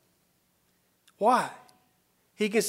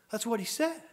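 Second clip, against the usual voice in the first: ordinary talk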